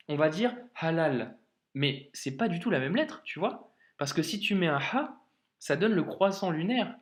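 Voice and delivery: normal talking voice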